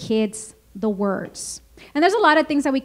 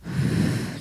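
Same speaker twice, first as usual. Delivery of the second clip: deep sigh; 8 dB quieter than usual. Shown as a demonstration. Modeling a breath with the child.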